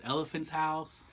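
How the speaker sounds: usual speaking voice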